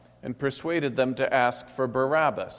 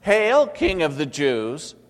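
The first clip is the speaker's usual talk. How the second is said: mocking call; 6 semitones higher, 7 dB louder, slower than usual. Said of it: "They mocked him and began to call out".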